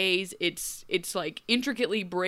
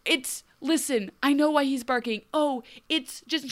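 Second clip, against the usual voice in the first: higher, flustered mock protest; flustered Dorothy; 6 semitones higher, 3 dB louder than usual. Dorothy defending her barking dog, higher mock fluster. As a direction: sputter like a rattled girl caught out. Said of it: "'cause she was like".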